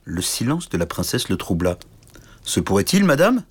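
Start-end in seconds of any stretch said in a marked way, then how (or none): 2.4-3.4 high, rising threat; lightly voiced giant about to crunch him; menacing brute. A narrator's reading. Giant knight threatening to eat the tiny suitor, lightly voiced menace.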